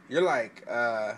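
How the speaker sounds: ordinary talk, nothing marked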